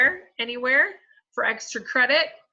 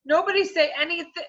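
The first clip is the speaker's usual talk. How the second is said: urgent warning; 7 semitones higher, 5 dB louder than usual. Acted. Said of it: "Jenny tried to warn".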